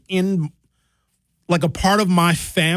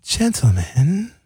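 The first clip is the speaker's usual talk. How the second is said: put-on drawn-out English-accented pleading; 4 semitones higher, slower than usual. Host imitating Bailey breaking up a fight, English accent. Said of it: "She got an English accent".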